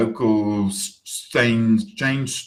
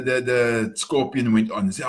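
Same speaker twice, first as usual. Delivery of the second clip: ordinary delivery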